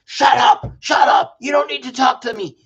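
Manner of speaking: high, loud shouted outburst; imitated angry yelling. Son imitating his triggered father shutting him down, angry yelling. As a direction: yell it like an enraged father. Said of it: "he'll yell. He's like".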